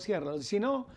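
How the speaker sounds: normal talking voice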